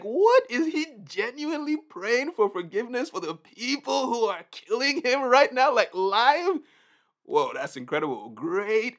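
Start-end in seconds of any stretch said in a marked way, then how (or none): 0.0-6.6 high, loud incredulous questioning; can't believe what you're seeing; stunned amazement, voice up high; shocked disbelief. Pretend. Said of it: "You're like".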